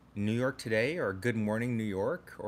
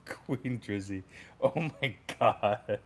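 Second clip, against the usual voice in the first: laughing hard; slower than usual. Real, not acted. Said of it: "I'm crying".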